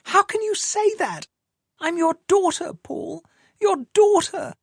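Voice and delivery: high, desperate woman's voice; distraught woman. Acted. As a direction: plead with him, voice high. Woman pleading with Paul.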